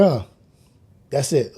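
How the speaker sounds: ordinary talk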